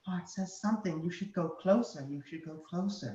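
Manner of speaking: high, soft little minion; small voice. Acted. Dragon's small voice coaxing him closer, small voice. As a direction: urge softly in a small, high voice. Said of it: "you hear the small voice go".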